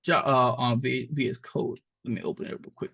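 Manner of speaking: ordinary talk, nothing marked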